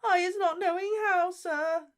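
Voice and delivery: very high, tearful gasping elf voice; Winky pleading with Diggory; squeaky crying elf. Acted. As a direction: gasp out a tiny elf's plea. Elf voice held.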